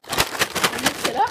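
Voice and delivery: slow and loud, stretching it out; loud, drawn out. Real, not acted. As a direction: announce it big and slow. Announcing mixing in wasabi.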